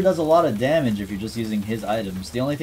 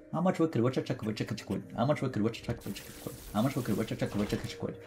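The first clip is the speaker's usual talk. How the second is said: fast, softer run of the tongue twister, over and over; rapid repetition; 9 dB quieter, much faster than usual. Shown as a demonstration. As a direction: mutter it quickly like in the shower. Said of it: "say that to myself over and over".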